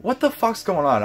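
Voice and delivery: high, exasperated retold yell; fed-up fan retelling his frustration; heated outburst. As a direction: voice leaping up in annoyance. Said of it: "I'm like".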